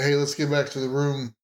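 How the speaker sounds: normal talking voice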